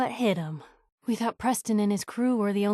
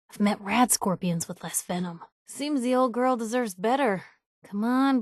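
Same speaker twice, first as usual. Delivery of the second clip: ordinary delivery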